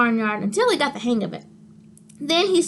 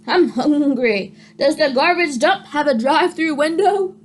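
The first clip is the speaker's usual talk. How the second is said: goat voice; 2 semitones higher, 3 dB louder than usual. A narrator's reading. Goat's thought voiced.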